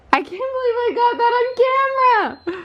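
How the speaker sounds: very high, slow excited squeal; amazed squeal. Real, not acted. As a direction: squeal it out in disbelief. Amazed at catching squirrel on camera.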